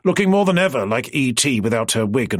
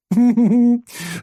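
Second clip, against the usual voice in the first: louder laugh breaking into the sentence; laughter; 12 semitones higher, 4 dB louder than usual. Acted. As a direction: laugh mid-sentence, can't help it. Laughing mid-explanation.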